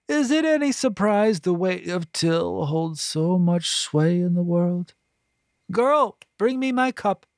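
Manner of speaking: put-on high, disdainful old woman voice; a scornful, self-pitying matron; bitter voluptuary. A narrator's reading. Voluptuary character voice.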